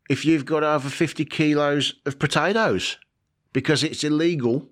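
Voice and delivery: mock Aussie delivering the punchline; Australian accent. Vlogger stating the potato law in a mock Australian accent. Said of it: "I'm gonna stop doing the accent now".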